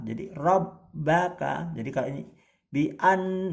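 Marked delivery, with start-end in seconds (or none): 0.3-1.7 Rabbaka with bb held and pressed; pressed doubled b. Shown as a demonstration. Teacher showing students tasdid, bb pressed. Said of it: "agak ditekan seperti Rabbaka".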